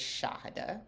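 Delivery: Shahada with a clear, stressed first syllable; correct name pronunciation. Shown as a demonstration. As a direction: say Shahada as SHAH-hada. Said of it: "can't pronounce the first syllable".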